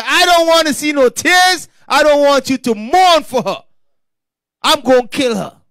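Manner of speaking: loud, high divine decree; stern command. Acted. God's stern command, acted out.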